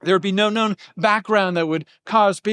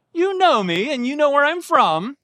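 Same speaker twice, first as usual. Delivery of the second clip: mock-agreeing, pitched higher, rising on "know" each time; higher sarcastic tone with a lift on "know"; 7 semitones higher than usual. Shown as a demonstration. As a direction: let the sarcasm ride up on "know". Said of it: "making a sarcastic statement".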